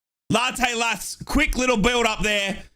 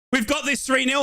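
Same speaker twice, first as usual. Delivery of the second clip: ordinary talk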